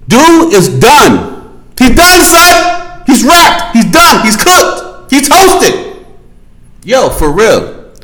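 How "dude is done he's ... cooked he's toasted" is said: hyped declaration. Real, not acted. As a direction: hammer it home like a verdict. Hyped declaration he's finished.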